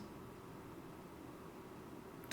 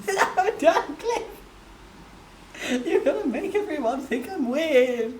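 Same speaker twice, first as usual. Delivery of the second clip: laughing protest; 9 semitones higher, 4 dB louder than usual. Real, not acted. Embarrassed protest at chat.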